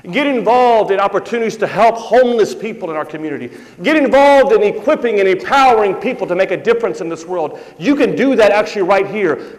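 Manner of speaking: rally the congregation to act; preaching at full force. Acted. Pastor urging church to get involved, rising preaching voice.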